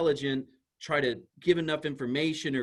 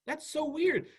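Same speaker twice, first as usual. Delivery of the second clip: puzzled vendor; 13 semitones higher, slower than usual. Pretend.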